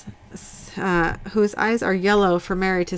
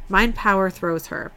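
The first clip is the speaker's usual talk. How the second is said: ordinary talk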